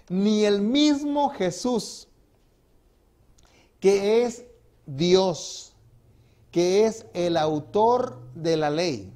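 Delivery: each phrase drawn out very slowly; preaching slowly to make it sink in; slow emphatic preaching. Real, not acted. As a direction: lean on every word, very slow.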